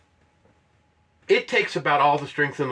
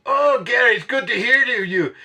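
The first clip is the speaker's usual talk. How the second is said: raised, loud hello; hearty friend greeting; 8 semitones higher, 7 dB louder than usual. Retold or imitated. Retired climbing partner greeting his friend by phone, hearty. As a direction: greet an old pal heartily.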